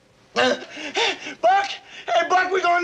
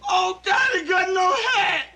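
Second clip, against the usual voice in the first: crying, pleading shouts; sobbing panic; 3 semitones higher, slower than usual. Acted. Airman sobbing to God over his headless buddy, crying shouts. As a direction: beg through tears in horror.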